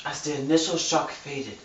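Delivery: soft, hushed novel narration; quietly dramatic book narration; dramatic reading. Acted. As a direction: read it like a brooding thriller. Reads the novel aloud dramatically.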